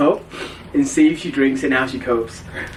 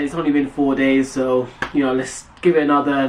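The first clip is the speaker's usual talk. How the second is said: ordinary delivery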